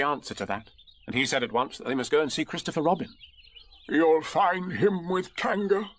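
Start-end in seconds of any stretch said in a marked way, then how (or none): 3.9-5.9 slow, higher donkey voice; Eeyore. A narrator's reading. Donkey directing the friends onward, slow and high. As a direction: intone it slowly, pitched up. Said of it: "said Eeyore".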